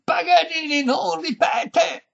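high, loud, drawn-out shout; dismayed yell. A narrator's reading. Violinist misheard by deaf lady, slow high shout. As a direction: bellow each word slowly, exasperated. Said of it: "urlò Paganini sgomento".

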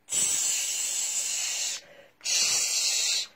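breathy tsh hissing; snake hiss. Acted. Snake sound in story.

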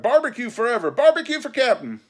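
high, loud acclamation; rally the crew with a rousing shout; cheering cry. A narrator's reading. Mutineers electing Barbecue as captain, cheering cry. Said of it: "they cried".

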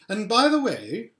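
a queen slipping in a secret; Queen's voice. A narrator's reading. Queen adding a secret request to Edmund, lightly voiced.

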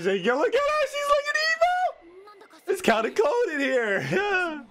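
high, slow eerie put-on voice; mock spooky voice. Reactor hamming up the girl's evil aura, spooky. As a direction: play up her evil chill.

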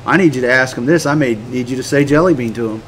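low, soft voice of God; God's quiet instruction. Acted. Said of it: "he may just go".

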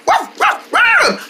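high playful ruff ruff; puppy bark. Pretend. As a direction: bark like an excited pup. Puppy greets mommy.

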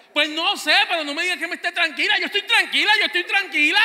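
high, loud, fast insisting; agitated denial. Retold or imitated. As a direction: insist you're calm while clearly not. Esposa insiste que está tranquila. (negación alterada de la esposa)

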